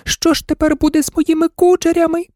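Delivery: very high doll voice, lamenting; wailing doll. A narrator's reading. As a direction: wail like a vain, frightened doll. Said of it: "лялька Роза залементувала". (тоненький лемент ляльки)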